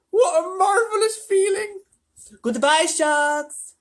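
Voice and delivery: very high, loud, slow joyful cry. A narrator's reading. Friends escaping the sharks, elated cry. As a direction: whoop with drawn-out delight. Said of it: "they cried".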